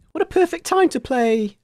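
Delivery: ordinary delivery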